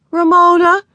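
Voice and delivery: high, loud exasperated call; crying out. A narrator's reading. Older sister cries out at noisy little sister, exasperated. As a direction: cry out the name, rising. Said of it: "cried Beezus".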